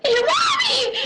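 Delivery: put-on high, frightened child's scream; panicked cry. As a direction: scream for mommy. Child panics on hearing Kamal.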